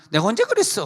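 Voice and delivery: high-pitched, mock-innocent daughter; playful denial. Daughter denying her words.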